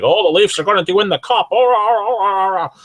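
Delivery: high, loud imitation of hyped-up fans; mock the fans with high, loud whooping; over-excited fan voice, hammed up; Average Joe. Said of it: "sounded just like Average Joe".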